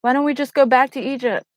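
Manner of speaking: a mild mock of the Israelites whining to go back; mock complaining. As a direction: grumble like an ungrateful crowd. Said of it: "they start complaining".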